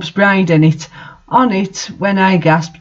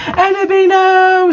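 much higher, hammed-up shriek; mock scream; 12 semitones higher than usual. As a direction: shriek it like a soap-opera heartbreak. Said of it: "screamed Draco".

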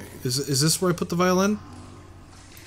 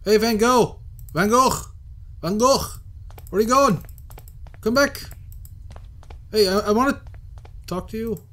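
calling out; 8 semitones higher than usual. Real, not acted. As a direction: shout to make him stop and come back. Calls after fleeing figure.

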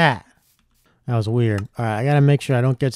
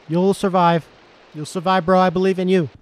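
normal talking voice

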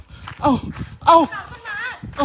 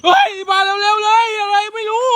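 excited call; 8 semitones higher than usual. Real, not acted. Startled, calling others over.

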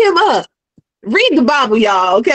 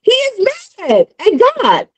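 ordinary delivery

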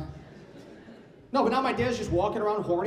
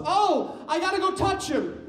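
eager mom; 11 semitones higher, slower than usual. Retold or imitated. Mom excited in airport.

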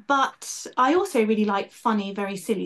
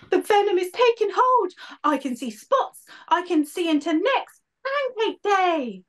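high, melodramatic dying hero; high hammed-up deathbed delirium; 10 semitones higher, 3 dB louder than usual. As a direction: overplay the poisoned knight fading. Poisoned Betty's melodramatic deathbed speech.

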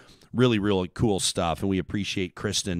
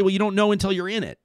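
quick, higher, louder mock objection; imagined objector; 10 semitones higher, 5 dB louder, faster than usual. Host voicing a skeptic's reply to his question, mock objection, higher and louder. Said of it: "Or maybe you say".

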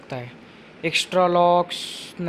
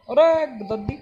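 startled shout; 7 semitones higher, 7 dB louder than usual. Real, not acted. Granny suddenly appears.

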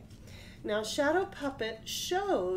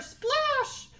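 splash; 5 dB louder than usual. A narrator's reading. Troll falls in river.

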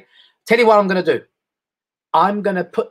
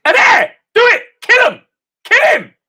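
much higher, loud frenzied yelling; Armenian inner voice; 8 dB louder, slower than usual. Retold or imitated. Inner Armenian voice urging him to hit his sons, shrieking. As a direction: scream it like a wild devil on his shoulder. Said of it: "the Armenian voice in me said".

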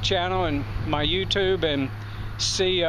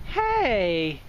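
much higher, sing-song voice for animals; pet talk; 6 semitones higher than usual. Real, not acted. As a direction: coo at the llamas like pets. Cooing at the llamas.

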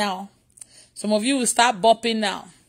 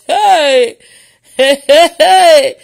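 loud, rising whoops of hey; big, elated cries; exultant shouting; 3 semitones higher, 10 dB louder, much slower than usual. Real, not acted. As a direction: shout it out, laughing between cries. Exulting at viewers' reported reactions.

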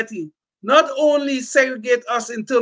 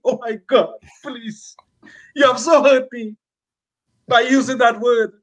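a hint of a wounded, offended voice; mock offended. Mocking the easily offended, he puts on a mild wounded voice. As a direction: lightly mimic someone hurt by a word.

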